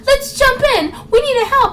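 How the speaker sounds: squeaky-high hammed-up rallying cry; mock game heroines. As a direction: imitate melodramatic girls leaping in. Mocking Riku and Paine in game.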